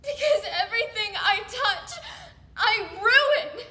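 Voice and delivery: anguished, crying outburst with broken pauses; tearful self-loathing. Acted. Confessing to someone hurt by them, tearful anguish. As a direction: force the words out through sobs, voice rising.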